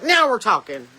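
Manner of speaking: a lightly voiced God, pleased; a hint of a delighted deity; God approving. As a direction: play God liking the idea. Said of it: "God's like".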